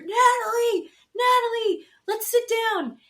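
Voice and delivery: high, loud call across the resort; calling after her. Retold or imitated. Josh chasing Natalie through the resort, calling after her.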